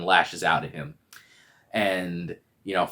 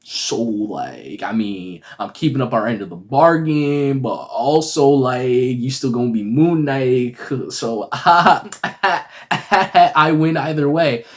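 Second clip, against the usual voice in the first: drawn-out teasing with chuckles; mock-smug, louder toward the laugh; hammed-up gloating; 4 semitones higher, 5 dB louder, slower than usual. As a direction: gloat like a jerk who wins regardless. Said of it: "he's also a dick".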